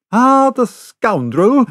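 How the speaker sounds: high, loud outraged exclamation; indignant cry. A narrator's reading. Servant cries out at the stone-throwing native, indignant. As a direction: cry out at the savage. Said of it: "cried Conseil".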